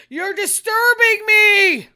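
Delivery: high, loud, slow indignant shriek; woman shrieking at a clerk; angry shriek. Retold or imitated. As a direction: shriek it at an intruder. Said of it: "this lady".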